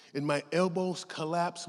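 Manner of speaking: ordinary talk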